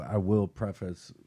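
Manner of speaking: ordinary delivery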